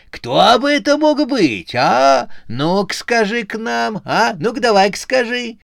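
put-on very high-pitched cantankerous old-witch voice; shrilly goading character voice; quarrelsome. A narrator's reading. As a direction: needle them with squawky, insistent questions. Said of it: "сварливо отозвалась Матрёна Фёдоровна".

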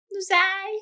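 put-on high cooing girly voice; straight girl voice. Shown as a demonstration. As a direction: coo it up high and sweet. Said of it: "вот так звучат женщины-натуралки".

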